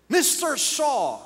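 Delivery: high, loud, drawn-out outrage; angry, shocked. Retold or imitated. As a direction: call him out as an offended woman. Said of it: "She was so angry".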